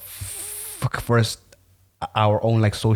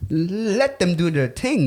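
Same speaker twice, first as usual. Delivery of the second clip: laid-back quote; 9 semitones higher, much slower than usual. Retold or imitated. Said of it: "I was kinda like".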